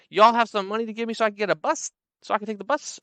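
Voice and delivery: high, fast pleading woman. Retold or imitated. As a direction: rush the ask for bus money. Same woman asking money.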